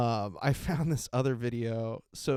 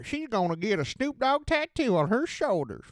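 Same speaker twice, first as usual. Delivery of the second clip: Mississippi newscaster; 10 semitones higher, 5 dB louder than usual. Retold or imitated. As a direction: imitate the co-anchor in a Mississippi accent, pitched up. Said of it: "that's just how fucking newscasters sound in Mississippi".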